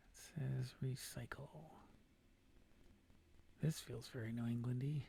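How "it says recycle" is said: reading the sign in a whisper. Real, not acted. Player reading a road sign, whispered to himself. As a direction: murmur it to yourself, voiceless.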